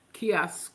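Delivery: Kiosks with a crisp final s-k-s; Kiosks, said as a tricky word; careful pronunciation. Shown as a demonstration. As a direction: sound out the end of Kiosks carefully. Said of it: "That's how you say that".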